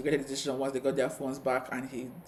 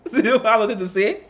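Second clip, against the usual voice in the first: laughing, high and loud gleeful quote; gleeful laughing retort; 7 semitones higher, 10 dB louder than usual. Retold or imitated. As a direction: cackle through the punchline. Retelling a juicy moment.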